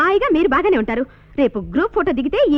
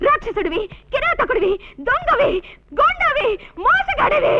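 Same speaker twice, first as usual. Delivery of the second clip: angry name-calling; 9 semitones higher, slower than usual. Acted. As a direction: pitch climbing to a high, angry shout. Enraged woman hurls insults at him.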